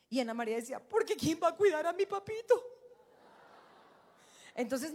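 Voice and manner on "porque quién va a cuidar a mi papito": put-on pleading little girl. Daughter voiced, high pleading.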